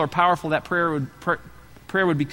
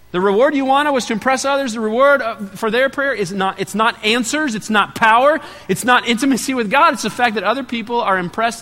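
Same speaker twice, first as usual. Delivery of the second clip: rising, louder pulpit emphasis; preaching at full force; 7 semitones higher, 4 dB louder than usual. Acted. Pastor preaching to the congregation, rising and forceful. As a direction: drive each "it's not" home harder.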